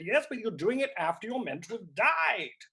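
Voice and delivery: retold exasperation. Imitating husband objecting during Hamlet, mock exasperation. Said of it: "he got very angry".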